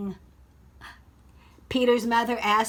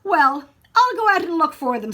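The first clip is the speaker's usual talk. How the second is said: boy's faked dad voice, louder and higher; Peter as dad; 7 semitones higher, 9 dB louder than usual. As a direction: keep playing the pretend father. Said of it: "said Peter".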